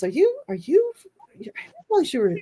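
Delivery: put-on quoting the kid in a high, fast voice; high kid's voice. Asking the psychic in a high kid voice. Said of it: "one of them says".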